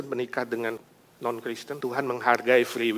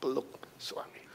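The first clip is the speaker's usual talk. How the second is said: ordinary delivery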